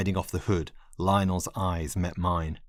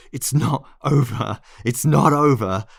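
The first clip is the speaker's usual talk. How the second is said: louder, higher, frantic yelling; frantic shout; 6 semitones higher, 7 dB louder than usual. Acted. Narrator staggering out after Lionel, frantic loud yell. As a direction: shout it like a revived monster.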